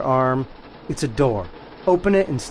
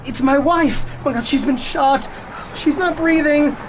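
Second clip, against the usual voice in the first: distraught panic; 10 semitones higher than usual. Acted. Distraught husband, high pitch.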